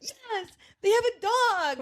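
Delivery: put-on high, slow, thrilled kid voice; delighted kid. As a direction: gush like a happy child. Her delighted child self.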